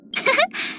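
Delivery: quiet high chuckle; soft giggle. Acted. Listener delighted by good music, soft giggle. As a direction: let out a small pleased laugh.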